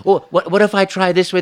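high, bright eager plea. Retold or imitated. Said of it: "And then I'll go".

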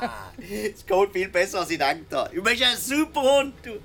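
doggy praise. Acted. Praising the dog after song.

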